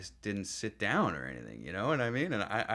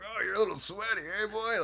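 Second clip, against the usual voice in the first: higher mocking tease; teasing; 12 semitones higher than usual. Veteran teasing the sweaty new guy, higher mock voice. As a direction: bust his balls with a sneer. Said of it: "just busting my balls".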